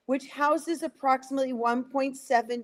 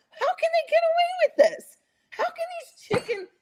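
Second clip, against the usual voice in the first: incredulous outrage. Retold or imitated. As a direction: cry out in disbelief and anger. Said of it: "In my mind, I'm thinking".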